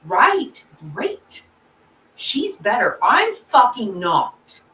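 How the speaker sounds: mock-angry outburst, loud in bursts; angry imagined shout. Grieving daughter imagining answering those offering comfort, angry outburst. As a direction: let the bitterness punch out on the swear words, short of a full yell. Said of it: "what I wanted to shout as loud as I could".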